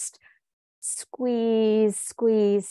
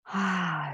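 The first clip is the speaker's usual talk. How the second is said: relaxing exhale. Shown as a demonstration. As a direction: sigh out as you sink down. Models a deep exhale.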